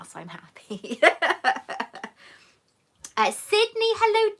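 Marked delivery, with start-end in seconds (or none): 0.0-1.0 softly whispered happy aside. Real, not acted. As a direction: whisper it to yourself, pleased. Whispered aside, matching nails.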